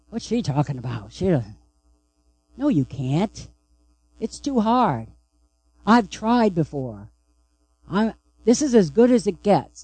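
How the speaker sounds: near-whisper of a small doubting voice; inner child doubting. Pretend. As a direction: let the hidden child inside murmur its doubts, very soft. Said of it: "your little girl or boy is saying".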